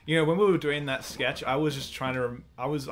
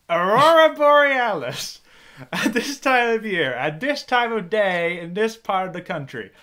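high, loud, slow disbelief; incredulous; 8 semitones higher, 11 dB louder, slower than usual. Acted. Superintendent doubting the excuse, loud slow incredulity. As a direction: drag it out in disbelief.

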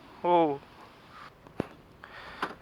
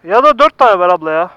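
loud call to the shopkeeper; 4 semitones higher, 11 dB louder than usual. Real, not acted. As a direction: call it out over the noise. Calling to the bread seller. (yüksek sesle seslenerek)

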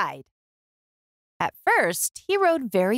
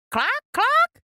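much higher, clipped clucks; clucking chicken. A narrator's reading. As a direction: cluck like a hen. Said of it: "said Chicken".

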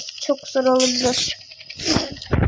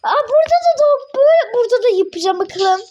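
high and loud, rushing in; excited; 9 semitones higher, 4 dB louder, faster than usual. Real, not acted. Gamer kid to viewers, excited high squeal. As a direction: squeal at the new level.

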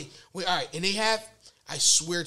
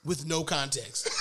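normal talking voice

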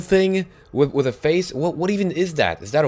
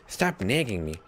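normal talking voice

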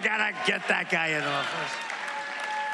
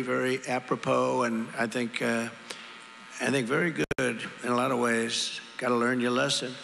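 ordinary talk, nothing marked

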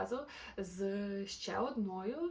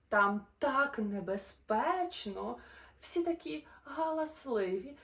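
lively, sing-song storybook narration; animated bedtime-story voice; reading to children; 5 semitones higher, 4 dB louder than usual. A narrator's reading. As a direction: read the rhymes brightly to little listeners. Reads a rhyming children's story.

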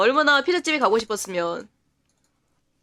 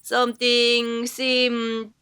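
extremely slow, every word stretched out; drawn-out reading; much slower than usual. Acted. Player reading a game customer's strange line, extremely drawn out. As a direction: drag each syllable out in a long, gliding drone. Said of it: "이거 말하는 게 왜 이러냐".

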